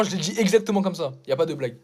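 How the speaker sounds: ordinary talk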